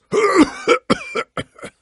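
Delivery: harsh, high coughing fit; hacking cough. Acted. Sickly hero breaking into a hacking cough. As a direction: cough hard and rough.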